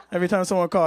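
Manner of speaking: ordinary delivery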